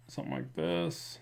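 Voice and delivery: quiet muttered lisp, said off to the side; soft lisp. Real, not acted. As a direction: murmur it with a slight lisp. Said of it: "brings out my lisp".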